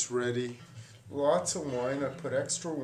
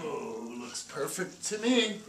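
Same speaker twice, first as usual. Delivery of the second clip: ordinary delivery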